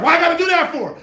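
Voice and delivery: high, loud mocking whine; mock whiny retort. Mocking a sister's reply, high loud whine. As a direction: squeal it like a sassy sister. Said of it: "It's like".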